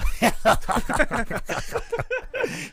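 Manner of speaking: high, giggly sinister laugh; creepy laugh. Pretend. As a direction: laugh like something is lurking. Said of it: "thinks I have a creepy laugh".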